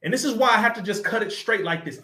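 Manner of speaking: ordinary talk, nothing marked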